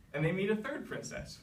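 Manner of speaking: ordinary delivery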